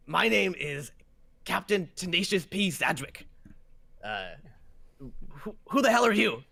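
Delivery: captain introducing himself, building to indignation; haughty ship captain. Acted. Captain demands to know who the stranger is, haughty and indignant. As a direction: announce the name grandly, then demand loudly.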